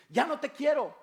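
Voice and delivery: put-on high, loud childish retort; angry child. Child answering the parent back, high and loud. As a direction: snap back like a sulking kid.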